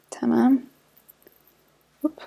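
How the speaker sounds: ordinary talk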